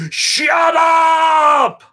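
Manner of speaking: high, loud bark of shut up; Kindergarten Cop shout. Retold or imitated. Arnold turns on the yelling Microsoft staff, loud movie shout. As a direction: yell it like the cop silencing the kids. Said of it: "like from my movie".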